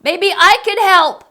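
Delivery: high, loud eager call; shout over the wind. Acted. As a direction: yell to be heard over the storm. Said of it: "I shouted over the wind".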